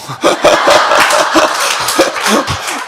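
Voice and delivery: loud laugh with a rising shout; loud laughter breaking out; big laugh. Real, not acted. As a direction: burst out laughing at the joke. Crowd-pleasing joke lands.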